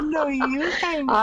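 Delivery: high sing-song lovey teasing; giggly late night call. Pretend. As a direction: coo it like a smitten teen. Playful hang-up routine, high.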